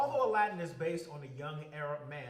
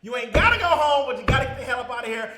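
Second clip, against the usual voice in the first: loud, high and fast shout; shouted catchphrase; 8 semitones higher, 18 dB louder than usual. Acted. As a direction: yell the send-off with full force. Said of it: "I can't even speak right now".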